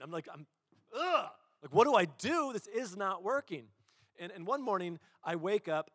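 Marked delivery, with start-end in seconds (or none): 0.9-1.3 high, loud exasperated "ugh"; acted burst of exasperation; frustrated groan. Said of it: "I'm getting so frustrated". 1.6-3.6 high, loud retold exasperation; exasperated plea, acted out; frustrated. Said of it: "I'm getting so frustrated".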